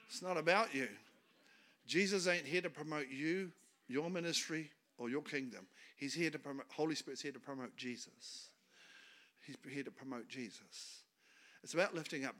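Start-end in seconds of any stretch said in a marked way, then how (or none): none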